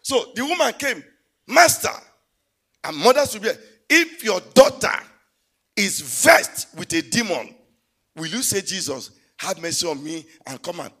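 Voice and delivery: loud, high pulpit voice; full-force preaching. Acted. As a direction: preach it hard to the crowd.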